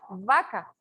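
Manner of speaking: vaca pitched low, with a V-like start; labiodental V. Shown as a demonstration. Teacher repeating the labiodental example, pronunciation demo. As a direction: teeth on lip to begin vaca, low. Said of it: "es con B labiodental".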